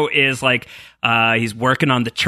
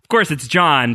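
normal talking voice

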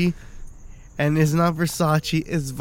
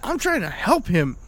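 confused protest; 12 semitones higher, faster than usual. Retold or imitated. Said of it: "I'm like".

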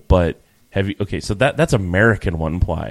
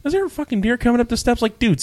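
much higher, fast disbelief; incredulous. Retold or imitated. Hearing something on the deck steps, high fast disbelief. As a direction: squeak it out in disbelief. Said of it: "I'm like".

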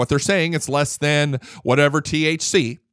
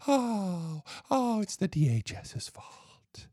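whispered, much softer mocking whine; 5 semitones higher, 9 dB quieter, much slower than usual. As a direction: hiss the excuse as a sarcastic whimper. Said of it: "And what do they do?".

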